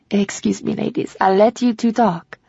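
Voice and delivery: French housekeeper excusing herself; bow out politely in a French accent. A narrator's reading. Housekeeper leaves the two friends to talk, French accent. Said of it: "with a heavy French accent".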